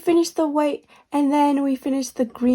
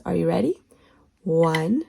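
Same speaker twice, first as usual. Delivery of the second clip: ordinary delivery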